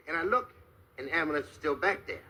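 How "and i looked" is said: ordinary talk, nothing marked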